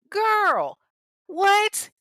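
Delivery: very high, loud disbelieving yelp; loud, high-pitched shock; stunned. Real, not acted. Teller's stunned reaction.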